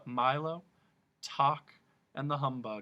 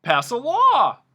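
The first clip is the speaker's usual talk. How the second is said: high, loud, slow and eager; eager humbug suggestion; bright suggestion; 12 semitones higher, 15 dB louder, slower than usual. A narrator's reading. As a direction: pipe it up like a brilliant idea. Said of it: "the humbug suggested brightly".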